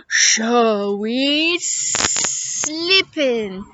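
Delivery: drawn-out hissing s and buzzing z; slippery snake. Acted. Snake answering how she'll cross, slow hissing voice. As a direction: stretch the sibilants slowly like a snake.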